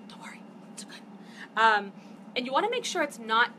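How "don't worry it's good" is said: soft hushed reassurance, fully whispered; reassuring whisper. Acted. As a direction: whisper it gently, like a secret comfort. Reassuring viewer after spelling mistake.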